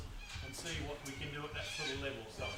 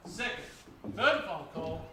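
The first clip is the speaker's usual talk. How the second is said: final call; 6 dB louder than usual. Real, not acted.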